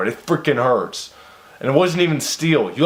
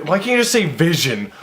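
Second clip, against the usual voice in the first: ordinary delivery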